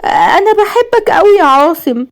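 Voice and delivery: high, slow, bashful; shy confession. A narrator's reading. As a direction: confess love shyly in a high girlish voice. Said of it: "وقالت بخجل". (بخجل)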